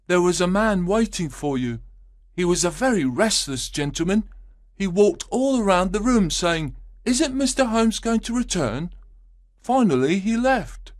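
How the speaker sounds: put-on high, boyish servant telling news; young page boy. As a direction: speak up high like an eager boy. Page boy reports the visitor.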